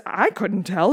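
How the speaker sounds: ordinary delivery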